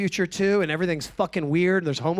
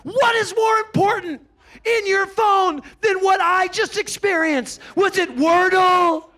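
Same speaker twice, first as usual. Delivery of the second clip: high, shouted mock tirade; mad; 13 semitones higher, 4 dB louder than usual. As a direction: scold the niece in exasperated, raised pitch. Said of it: "Why are you getting so mad".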